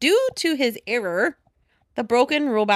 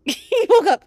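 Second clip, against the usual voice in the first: laughing; 12 semitones higher, 9 dB louder than usual. Real, not acted. Echoing punchline, laughing.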